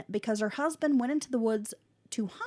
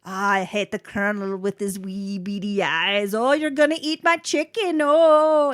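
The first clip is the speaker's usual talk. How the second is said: put-on Scottish accent; 9 dB louder than usual. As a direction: rage in a mock Scottish brogue. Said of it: "Scottish accent".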